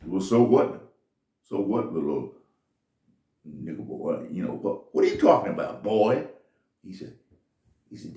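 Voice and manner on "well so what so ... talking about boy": loud, scornful put-down; contemptuous rich man. Retold or imitated. Rich men sneer loudly at the shoe shine boy.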